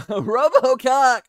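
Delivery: loud laughing exclamation; laughing shout. Real, not acted. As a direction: blurt it out through laughter. Laughing exclaim after joke.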